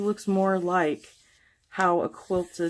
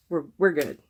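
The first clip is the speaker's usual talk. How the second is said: usual speaking voice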